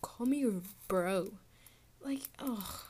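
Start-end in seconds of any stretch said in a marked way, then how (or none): 0.0-1.4 mock bro girl. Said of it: "Bro girls be like".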